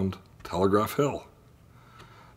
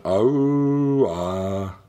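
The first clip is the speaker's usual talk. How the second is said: foghorn imitation. A narrator's reading. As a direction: call like a ship's foghorn in the fog. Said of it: "The old foghorn went".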